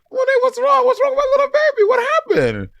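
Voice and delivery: put-on very high, quick baby-talk fussing; mock cooing concern. Retelling co-host's reaction to tears, mock cooing concern. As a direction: imitate someone gushing with worry over a baby. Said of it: "we're like".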